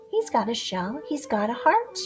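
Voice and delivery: ordinary delivery, nothing marked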